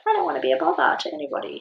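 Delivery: high, quick, apologetic little voice; good girl voice. Retold or imitated. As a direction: imitate a meek people-pleaser, rushed. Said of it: "my good girl brain".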